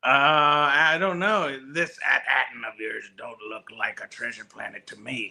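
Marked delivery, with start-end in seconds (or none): 1.7-5.3 gruff pirate growl, lower and softer; Brutus's pirate voice. Pretend. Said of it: "in Brutus's pirate voice".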